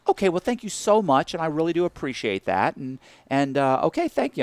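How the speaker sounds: sugary thank-you, lightly voiced; bank lady. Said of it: "she's like".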